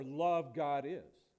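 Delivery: slow, with Love leading and punched; Love stressed, the rest falling away. Shown as a demonstration. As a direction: put the weight on the first word, Love. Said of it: "word that's being emphasized would be the first".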